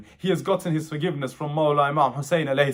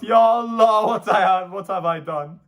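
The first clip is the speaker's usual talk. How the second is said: louder cry to God, fading then swelling; anguished calling out; 4 semitones higher, 5 dB louder than usual. Retold or imitated. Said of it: "He calls out".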